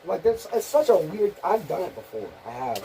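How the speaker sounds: normal talking voice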